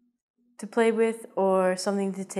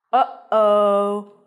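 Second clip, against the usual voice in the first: mock alarm; 7 dB louder than usual. Reacting to tooth pain.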